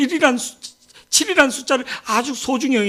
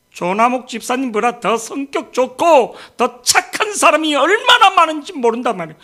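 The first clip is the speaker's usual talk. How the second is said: emphatic preaching; 4 semitones higher, 4 dB louder than usual. Real, not acted. Preacher compares others to a church deacon, emphatic and raised. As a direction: hammer the comparison home, pitch rising.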